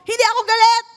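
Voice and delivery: high, loud irritated yell; hammed-up cranky mom insisting she's fine; mock angry denial. Tired mother denying anger to child, mock angry shout. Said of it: "bakit ganyan boses mo?".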